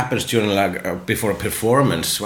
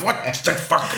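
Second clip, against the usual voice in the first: laughing; 10 semitones higher, faster than usual. Retold or imitated.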